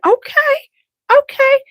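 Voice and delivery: high, eager okay okay; excited okay. Retold or imitated. Reading perfume notes, delighted high okay. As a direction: squeal it out, hooked by the bottle.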